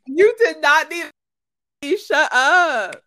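loud, high, drawn-out protest; slow, emphatic yell breaking toward laughter; mock outrage. Real, not acted. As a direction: shout your friend down in playful disbelief. Mock outrage at friend's exaggeration.

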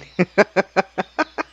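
a real high laugh breaking out. Real, not acted. Laughing at the memory.

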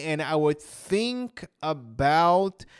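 very slow, drawn-out narration; showing how slowly he thinks the thought. Shown as a demonstration. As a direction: ease every word out slowly. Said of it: "in a very slow way".

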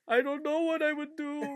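high, drawn-out wail; hammed-up tearful kid in despair; mock crying. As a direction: wail it slowly, imitating a crying child. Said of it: "crying on the floor".

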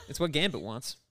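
ordinary talk

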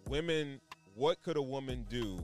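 ordinary talk

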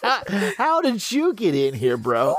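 high, laughing disbelief; can't believe a stranger cut into the call; baffled laughing. Pretend. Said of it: "I would be sitting on the other end like".

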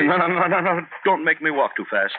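high, breathless protests; frightened pleading. Acted. Man dragged through the cemetery pleading, high and afraid.

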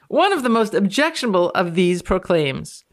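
normal talking voice

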